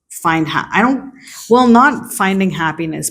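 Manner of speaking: normal talking voice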